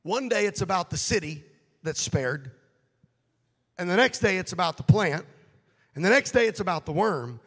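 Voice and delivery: loud, rising preacher's cry; preaching at full force. Acted. Preacher urging the congregation against anger, full force.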